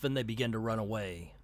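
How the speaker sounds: ordinary talk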